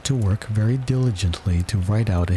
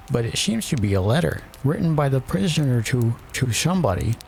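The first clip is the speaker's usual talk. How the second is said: high-pitched royal attendant; 5 semitones higher than usual. A narrator's reading. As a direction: pipe up high to the king.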